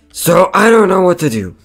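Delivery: high, loud helpless complaint; acted wail of being at a loss; exasperated lament. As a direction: throw the problem out in exasperation. Laments a falling-out.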